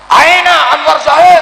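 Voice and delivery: high loud shout of his own name; shouted summons. Acted. Preacher imagining God shouting for him. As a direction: call him out like a shout from above. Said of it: "Allah sampai teriak-teriak".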